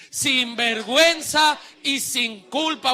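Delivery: slow, forceful shout; said loudly. Shown as a demonstration. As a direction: belt it out slowly for all to repeat. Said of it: "Ahora dígalo fuerte".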